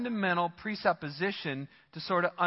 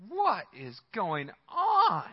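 slow plea to God; 3 semitones higher, much slower than usual. Retold or imitated. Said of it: "and he says".

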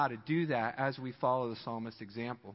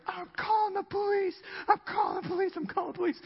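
very high, hammed-up frantic cry; mock panic; faster than usual. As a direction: squeal the threat like a terrified victim. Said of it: "Guy runs out of the car".

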